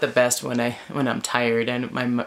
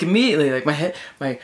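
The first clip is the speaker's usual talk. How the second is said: normal talking voice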